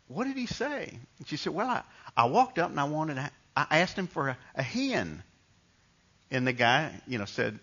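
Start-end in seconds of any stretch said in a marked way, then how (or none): 0.1-1.0 put-on high, soft child's question; little kid. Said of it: "I was just a little kid". 1.5-5.2 higher-pitched Southern mom; heavy Mississippi accent. Retold or imitated. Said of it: "Southern Mississippi accent".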